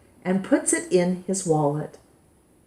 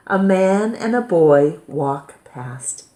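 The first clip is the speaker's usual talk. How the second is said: usual speaking voice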